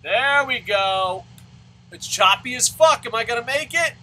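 excited game reaction. Real, not acted. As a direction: shout at the play, stretching the words. Tense moment in the game.

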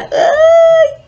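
very high, loud squeal of shock; shrill squeal. Real, not acted. Seeing the man's photo, a loud high squeal. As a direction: let out a piercing yelp.

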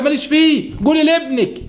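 high, dismissive brush-off; snubbing wife. Retold or imitated. Wife snubs mother-in-law. (رفض متعالٍ بصوت مرتفع)

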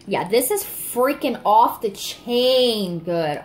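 slow, drawn-out gushing; thrilled. Real, not acted. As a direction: savour every word, genuinely delighted. Gushing over a wax scent.

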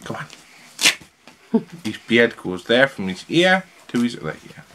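ordinary talk

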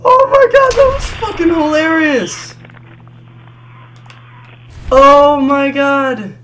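loud, high shouted amazement; hysterical excitement. Real, not acted. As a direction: yell in disbelief, still laughing. Cracking up at game glitch.

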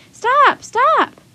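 very high, loud urgent cries; alarmed shout. A narrator's reading. Jack calls after the wagon, desperate shout. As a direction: yell to halt someone leaving. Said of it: "cried Jack".

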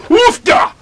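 loud high grunting exclamation; Minnesota grunt. Retold or imitated. Minnesotan straining to lift, loud grunt. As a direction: grunt it out like lifting something heavy. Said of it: "when you're grunting to do something in Minnesota".

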